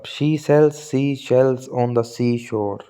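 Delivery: she sells sea shells on the seashore spoken slowly, each s sound clear; slow clear demo; slow tongue twister. Shown as a demonstration. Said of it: "एस की repetition हो रही है".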